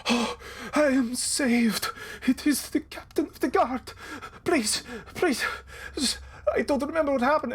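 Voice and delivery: high, quavering frightened merchant; trembling. Acted. Rescued merchant pleading with the captain, trembling. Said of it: "just sort of, like, trembling".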